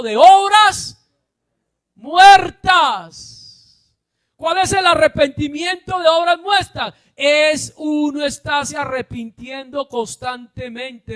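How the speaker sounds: loud, high preacher's shout; preaching at full force. Acted. Preacher shouting the point to his congregation. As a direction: drive it home to the congregation.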